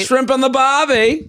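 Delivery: high, playful mock Australian accent. Host riffing on Australian clichés in a mock Australian accent. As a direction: tease with a cartoon Australian accent. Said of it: "try and do an Australian".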